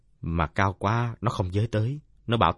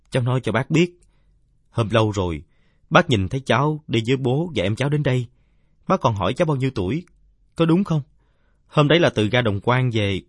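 lightly voiced earnest kid, a bit louder; patiently jog an elder's memory, voice slightly raised; insistent youngster; 4 semitones higher, 6 dB louder than usual. (giọng đứa cháu hơi cao, kiên nhẫn nhắc chuyện)

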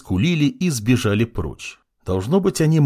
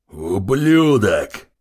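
slow, hoarse wheezed-out curse; hoarse wheeze; much slower than usual. Acted. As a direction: croak the insult out hoarsely. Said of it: "прохрипел демон".

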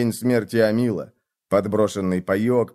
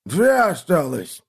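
put-on old archer voice; 5 semitones higher, slower than usual.

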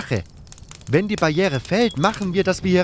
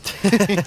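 hearty laugh; 5 semitones higher than usual. Acted. Companion laughs at his own joke.

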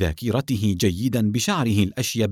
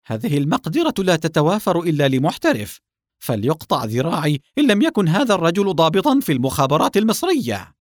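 high-pitched, emphatic declaration; emphatic conviction; 6 semitones higher, 4 dB louder than usual. Acted. Henchmen's chief insisting the man is an intelligence officer, emphatically. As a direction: state it with total certainty, pitch raised.

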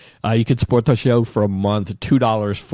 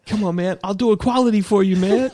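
high, loud pleading addict; begging addict; 11 semitones higher, 3 dB louder than usual. Pretend. Coworker offering favours for tea. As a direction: whine it high and desperate.

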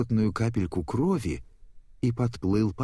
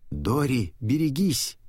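higher-pitched, only mildly raised cry; shouted warning; 5 semitones higher than usual. A narrator's reading. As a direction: urgently warn a friend. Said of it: "крикнул Марлин".

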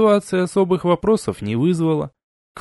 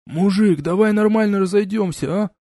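higher pleading gopnik voice; russian street thug; 6 semitones higher than usual. A narrator's reading. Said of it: "подал голос гопник". (гопник, упрашивая)

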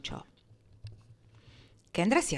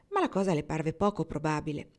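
usual speaking voice

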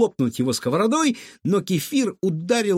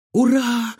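high, loud gleeful cheer; overjoyed; 10 semitones higher, 5 dB louder than usual. A narrator's reading. Girl cheers as the cupboard door gives way, overjoyed. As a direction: cheer with sudden delight. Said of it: "обрадовалась Илона".

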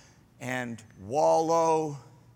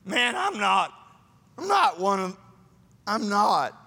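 Peter's flustered denial; 3 semitones higher, 3 dB louder, slower than usual. Retold or imitated. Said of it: "What an interesting way to phrase it".

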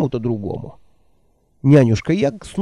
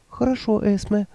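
put-on higher, timid old woman voice; meek old woman; 8 semitones higher, 3 dB quieter than usual. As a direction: answer meekly, eager to please. Nanny voiced by narrator.

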